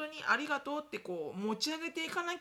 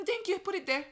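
dismissive brush-off; 8 semitones higher, 4 dB louder than usual. Retold or imitated. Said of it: "置いといてみたいなね".

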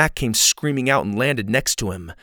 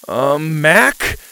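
nervous call; 3 semitones higher, 6 dB louder than usual. A narrator's reading. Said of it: "he called out".

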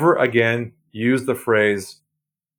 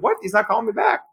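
higher, puzzled disbelief; mock dismay; 9 semitones higher, faster than usual. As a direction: act baffled at the silence. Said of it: "You're like".

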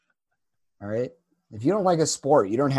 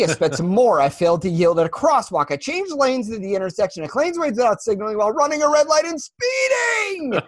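rising, louder rushed confession building to a shout; frantic confession; 6 semitones higher, 4 dB louder than usual. Retold or imitated. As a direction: blurt out every traffic offense, climbing to a yell. Quoting Liar Liar courtroom confession.

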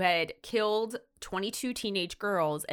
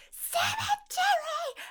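high, slow shout of Cemetery; hammed-up high yell of the word; much slower than usual. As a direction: yell it out like a kid spotting something. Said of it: "it's very, like".

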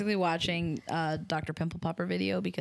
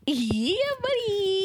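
gleeful yeah buddy; 11 semitones higher, 3 dB louder, much slower than usual. Acted. Teasing Josh about the topic.

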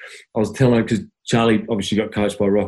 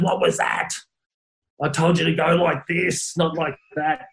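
Rocket's half-time spray; 7 semitones higher than usual. Retold or imitated. Coach scolding player at half time, high mock outrage. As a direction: imitate the exasperated coach scolding, pitched up. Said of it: "and he goes".

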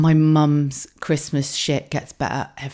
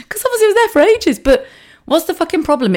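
ordinary delivery

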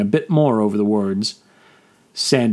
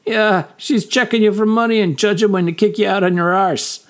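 higher, mocking young sailor; wry sailor voice; 10 semitones higher than usual. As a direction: tease the old man lightly. Sandish voiced.

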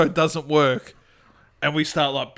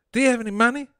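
little fat man; 7 semitones higher, faster than usual. Acted. Trapped little man asks Fred for money, high voice. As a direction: ask urgently in a high character voice. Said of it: "the little fat man said".

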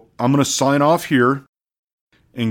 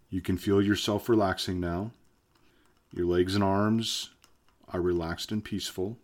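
hypnotist voice; 4 semitones lower, 9 dB quieter than usual. Acted. Hypnosis induction continues.

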